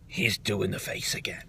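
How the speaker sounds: soft, low unvoiced aside; conspiratorial whisper. Vlogger confiding to viewers about Roman, whispered. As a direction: lean in and whisper it.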